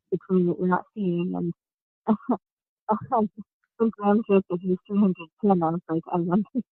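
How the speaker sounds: muffled, as if through a hand. Real, not acted. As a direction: talk with a hand over the mouth. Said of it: "you sound very muffled".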